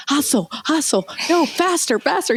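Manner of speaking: high-pitched urgent coaching; Ryan's dad. Retold or imitated. Traveler's dad giving running lessons in the terminal, imitated urgent urging. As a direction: imitate someone urging a runner on. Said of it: "Ryan's dad's like".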